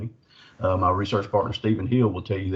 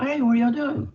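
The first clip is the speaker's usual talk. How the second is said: much higher, chirpy phone greeting; sister-in-law's voice. Retold or imitated. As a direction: pitch it way up like a cheerful caller. Voicing sister-in-law on phone.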